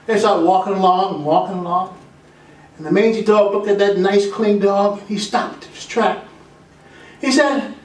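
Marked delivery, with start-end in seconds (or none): none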